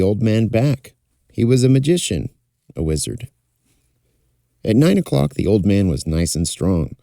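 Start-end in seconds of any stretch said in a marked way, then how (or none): none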